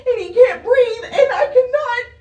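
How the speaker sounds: fighting back tears while pleading; crying. Real, not acted. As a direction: voice cracking into real sobs, pitch up. Mother pleading about sick son.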